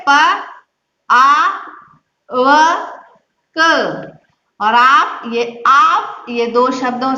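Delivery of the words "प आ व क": प, आ, व, क each sounded out separately with pauses; syllable by syllable. Shown as a demonstration. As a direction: sound out प आ व क one at a time. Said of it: "आप कैसे करोगे".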